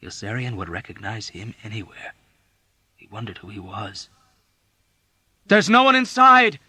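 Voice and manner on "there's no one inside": high loud sudden yell. A narrator's reading. Dunbar yelling at Yossarian. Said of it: "Dunbar yelled out at him unexpectedly".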